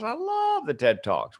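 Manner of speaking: imitated gush, high drawn-out "love"; gushing enthusiasm. Passenger hearing about the TED Talk, acted gushing. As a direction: stretch "love" high like a delighted fan.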